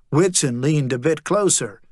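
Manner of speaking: ordinary delivery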